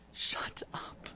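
breathless whispered shut up; a sharp whispered rebuke; whispered hush. Acted. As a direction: hiss it under the breath. Hushed aside at Peter.

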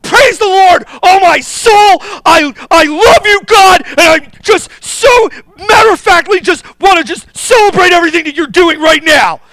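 loud, high, sarcastic gushing praise yelled through mock tears; mock the angry person gushing sweetly at God; over-the-top sarcastic worship cry. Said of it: "Anybody go".

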